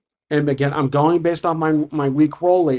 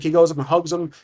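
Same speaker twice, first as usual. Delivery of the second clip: ordinary talk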